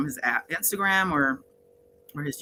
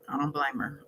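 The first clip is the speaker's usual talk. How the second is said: much softer, trailing echo of "I don't blame her"; soft repeat; 2 semitones lower, 7 dB quieter than usual. Real, not acted. Host sympathizes with the nervous friend, softly repeating. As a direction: drop it to a murmur the second time.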